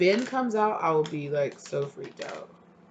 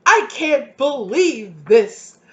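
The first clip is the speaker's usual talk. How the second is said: mock disbelief; 6 semitones higher, 10 dB louder, much slower than usual. Voicing the client's disbelief.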